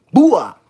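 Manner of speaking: short vocal crash sound; imitated crash noise. Driver retelling the collision, mimicked crash. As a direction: make the impact with one burst of voice.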